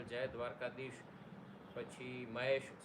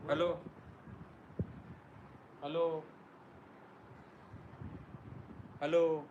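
louder mic check; slower than usual. Real, not acted. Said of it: "અવાજ ધીમો આવે".

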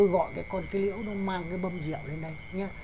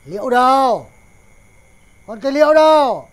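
loud, higher call to the kitchen; 5 semitones higher, 14 dB louder than usual. Acted. Father loudly calling his daughter Liễu from the kitchen. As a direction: bellow her name across the house.

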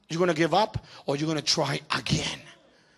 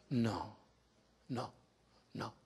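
ordinary talk

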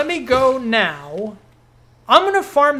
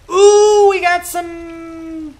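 excited ooh; 8 semitones higher, 7 dB louder, much slower than usual. Real, not acted. Delighted at new shop item.

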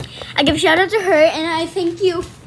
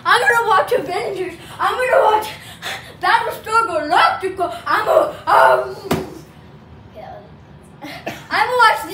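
impression of dad; 3 semitones higher than usual. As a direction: ham up your dad through giggles. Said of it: "I'm gonna do an impression of my dad".

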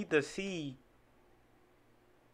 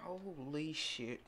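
stunned; 9 dB quieter, slower than usual. Real, not acted. Stunned reaction to freestyle.

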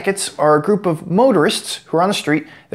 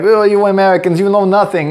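lightly put-on French accent, a bit louder; a hint of a snooty Frenchman scolding; mock French; 2 semitones higher, 4 dB louder than usual. Imitating a French person scolding Americans, mildly snooty. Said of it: "some French people ... be like".

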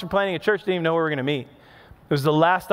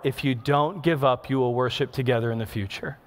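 softer, lower and gentle, like a quiet inner voice; 6 semitones lower, 4 dB quieter than usual. Retold or imitated. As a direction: breathe it softly as a tender promise. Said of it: "the Holy Spirit so gently spoke".

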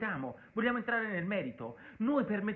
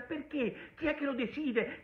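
ordinary talk, nothing marked

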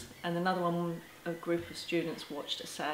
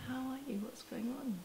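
caring whisper; 7 semitones higher, 8 dB quieter than usual. Retold or imitated. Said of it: "put their hand on your shoulder and they say".

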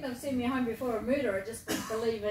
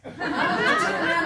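laughing; 8 semitones higher, 12 dB louder, slower than usual. Real, not acted. Laughing while retelling doctor scene.

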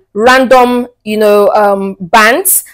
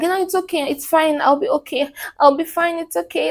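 high, soft martyr voice; higher, softer sulky woman; mock teary woman; 5 semitones higher, 7 dB quieter than usual. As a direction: play the wounded girlfriend sighing it off. Mocking woman's fake tearful reply.

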